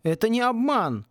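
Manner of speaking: wounded denial; offended. A narrator's reading. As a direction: object as if wronged. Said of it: "обиделся Сен".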